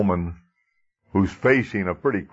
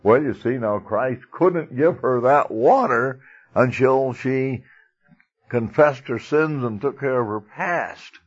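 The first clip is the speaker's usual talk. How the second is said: a hint of a moralizing minister, raised pitch, slowed; mock preachy minister; 6 semitones higher than usual. Preacher mocking other ministers, a hint of moralizing clergy. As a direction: lightly ham up the scolding clergyman. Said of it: "the minister's gonna say".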